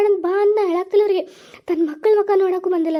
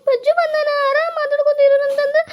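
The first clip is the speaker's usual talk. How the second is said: high-pitched weepy plea; tearful wailing; 6 semitones higher than usual. Acted. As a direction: beg them to stop while starting to cry. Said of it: "ನಮಗೂ ಅಳ ಬರುತ್ತೆ".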